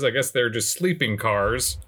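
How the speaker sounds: normal talking voice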